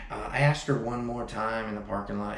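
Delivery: ordinary delivery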